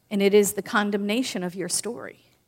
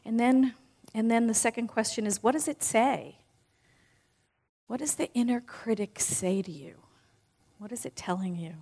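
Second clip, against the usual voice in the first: guided visualization; 2 semitones lower, 3 dB quieter than usual. Acted. Speaker guiding the audience through closing their eyes, softly.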